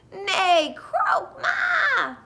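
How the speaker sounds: high, loud neigh, croak and bleat; animal noises. A narrator's reading. Narrator voicing animals climbing aboard, high animal cries. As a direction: run through excited storybook animal calls. Animals scramble in, voiced as chorus.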